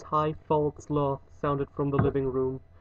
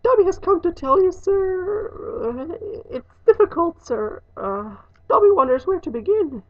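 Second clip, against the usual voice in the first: high-pitched elf, flustered and halting; a high elf voice searching for words; hesitant house elf; 5 dB louder than usual. Acted. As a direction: squeak it out, unsure where to start.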